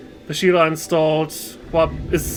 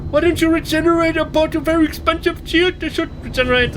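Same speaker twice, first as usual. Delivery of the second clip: high-pitched, hammed-up Indian accent; faux Indian accent; 12 semitones higher than usual. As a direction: complain in a squeaky fake Indian accent. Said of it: "faux Indian voice".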